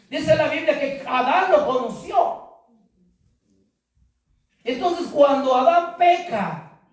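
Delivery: high, loud pulpit preaching; fiery preaching. Acted. Pastor urging his church to hear God's voice, loud preaching. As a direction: preach it out, building fire.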